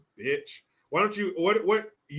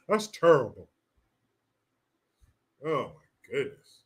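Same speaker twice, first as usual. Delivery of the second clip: usual speaking voice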